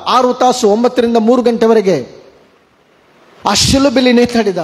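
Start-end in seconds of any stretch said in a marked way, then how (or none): none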